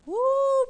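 loud high-pitched woo; sudden high, loud whoop of excitement; exultant whoop. Real, not acted. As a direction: let out a preacher's whoop. Preacher whoops after the rebuke.

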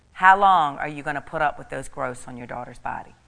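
loud, forceful voice of the Lord; booming divine command; strong voice. Retold or imitated. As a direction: boom it out as God rebuking. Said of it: "with a strong voice".

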